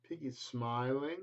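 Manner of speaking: ordinary delivery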